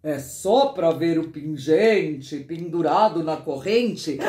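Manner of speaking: sly storybook villain making an excuse; coaxing uncle. Acted. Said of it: "pedindo que eu não fizesse alvoroço".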